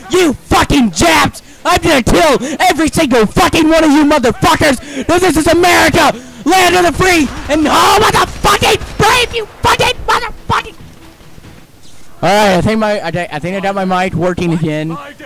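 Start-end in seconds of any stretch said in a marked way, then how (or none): 0.1-10.7 over-patriotic yelling. Pretend. Mock patriot threatening enemies over dead Americans, over-patriotic yelling. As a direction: scream it until the voice blows out. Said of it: "yelled too loud while being a little patriotic".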